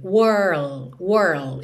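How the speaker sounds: "whirl" said slowly, curled R then L, no final D; demo of world. Shown as a demonstration. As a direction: stretch "whirl" with a curled R sliding into L. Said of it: "vai até o R enrolado". ("whirl" lento, R enrolado até o L)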